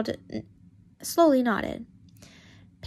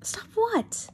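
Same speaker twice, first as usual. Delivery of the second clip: whisper; 11 semitones higher than usual. Acted. Pansy cutting in, whispered high.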